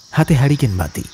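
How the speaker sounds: ordinary delivery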